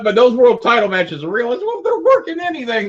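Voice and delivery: very high-pitched mock disbelief; hammed-up protest climbing into a squeal; retold incredulous protest. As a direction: act out arguing with a disbelieving friend. Retold argument convincing Cornette.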